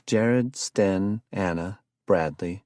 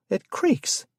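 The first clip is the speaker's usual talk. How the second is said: put-on lightly raised conspiracy-minded young man; excitable Jared. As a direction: sell the curse theory with eager alarm. Jared's voice again.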